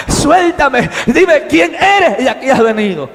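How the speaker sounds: loud, high-pitched challenge to a captor; shouted demand. Acted. Man confronting the stranger gripping his arm, shouted demand.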